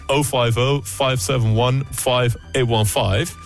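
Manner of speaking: ordinary talk, nothing marked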